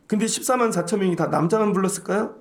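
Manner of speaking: normal talking voice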